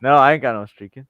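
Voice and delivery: normal talking voice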